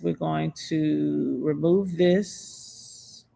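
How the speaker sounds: ordinary delivery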